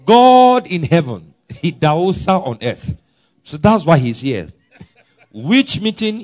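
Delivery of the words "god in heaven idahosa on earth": louder, slower, ringing declaration; loud proclamation. Retold or imitated. Preacher booming Idahosa's boast to the crowd, slow and loud. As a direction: thunder out the bold claim.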